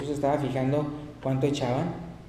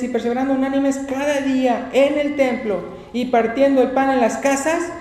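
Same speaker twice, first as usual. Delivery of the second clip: raised, higher-pitched proclamation; scripture reading; 8 semitones higher, 5 dB louder than usual. Acted. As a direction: read the verse out strongly to the congregation. Reads scripture aloud at full force.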